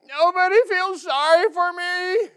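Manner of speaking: high, loud mock whine; a hammed-up self-pitying king wailing nobody feels sorry; whiny pity party. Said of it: "And he's like".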